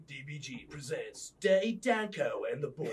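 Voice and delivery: announcer intro. Retold or imitated. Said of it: "It's like F X introduction".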